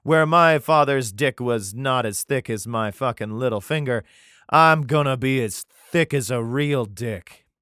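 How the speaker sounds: loud hammed-up tough-guy boast; mock belligerent king. King threatening the people with harsher rule, mock belligerent. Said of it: "he's like".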